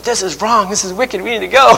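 high, urgent imitation of a wife; mock alarm. Wife protesting during church music, in mock alarm.

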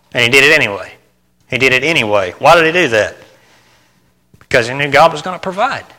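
ordinary delivery

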